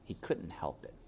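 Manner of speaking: ordinary delivery, nothing marked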